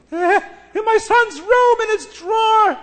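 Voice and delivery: very high, slow, breathless squeal; frantic mother. Acted. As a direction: stretch it out high like a panicked mother. Mother answers, still frantic.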